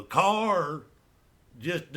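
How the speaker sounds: ordinary talk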